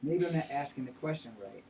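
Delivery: usual speaking voice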